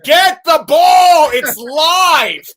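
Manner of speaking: very loud, slow, high yell across a stadium; screaming from the stands. Retold or imitated. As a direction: shout at the player to grab the ball. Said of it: "I'm at the game on his corner screaming".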